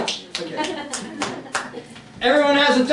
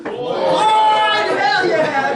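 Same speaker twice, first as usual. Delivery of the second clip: cheer; 8 semitones higher, much slower than usual. Pretend. Crowd cheering for barber.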